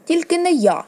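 high, emphatic outburst; girl's sharp cry of refusal; exclaiming. A narrator's reading. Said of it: "вигукнула Арія".

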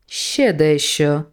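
mysterious voice. Acted. Voice behind the letter slot detaining the angel, slow. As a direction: drag the words out from behind the door. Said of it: "сказав голос".

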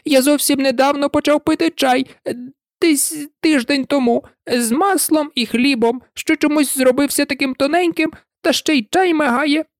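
a scared hatter babbling excuses; high, jittery explanation; high nervous hatter. A narrator's reading. Hatter rambling.

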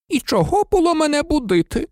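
grumpy portrait lady. A narrator's reading. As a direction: complain at being woken again. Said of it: "сварливо буркнула гладка пані".

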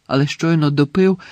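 plain reading voice

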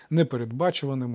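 ordinary delivery

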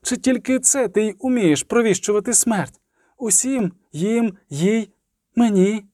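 high-pitched, restrained outcry; crying out. A narrator's reading. Triss demanding whether it only foretells death, high outcry. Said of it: "крикнула Тріс".

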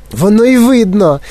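high, indignant cry; shrieking. A narrator's reading. Mother retorts to Wonka, shrieking. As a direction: throw it back shrilly. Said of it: "заверещала пані Борегард".

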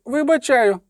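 high, squeezed poet's voice; squeezed-out forgiveness. A narrator's reading. Poet pardoning his executioner, squeezed out high. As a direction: force it out high, then let it fall. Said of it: "вичавив Любисток".

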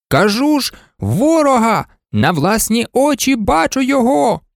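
high-pitched insistent calling; excited pilot. A narrator's reading. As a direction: call it down from the plane. Pilot calls down from his plane. (високий вигук схвильованого пілота)

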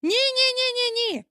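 put-on very high, rapid-fire childish no; squealed protest; chorus of refusal. A narrator's reading. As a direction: refuse like a whole gang of children. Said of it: "відказали всі в один голос".

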